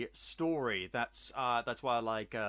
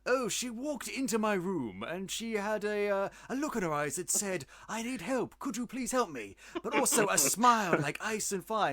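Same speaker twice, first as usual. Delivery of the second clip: put-on English accent; 5 semitones higher than usual. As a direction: narrate like an English noir detective in an English accent. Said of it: "hard-boiled gumshoe English person like".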